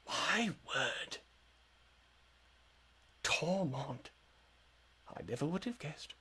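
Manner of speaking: put-on hushed, astonished old wizard; old man wizard. Wizard recognizing Tormont, hushed old-man surprise. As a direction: murmur it in soft wonder, with long pauses. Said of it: "old man wizard".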